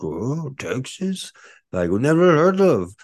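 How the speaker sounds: high, slow-starting mock group voice, getting loud; imitated puzzled Micronesians. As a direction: mimic locals baffled by an unknown name. Mimics group puzzled by Texas.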